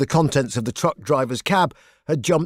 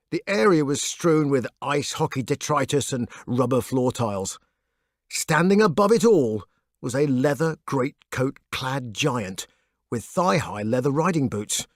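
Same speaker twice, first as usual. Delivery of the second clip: ordinary delivery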